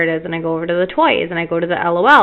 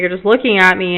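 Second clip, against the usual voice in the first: usual speaking voice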